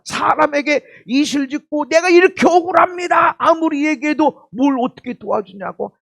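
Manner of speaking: loud, high shout of injustice; hammed-up aggrieved wail; mock wronged outcry. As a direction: cry out your grievance to anyone who'll listen. Acted plea of injustice to people.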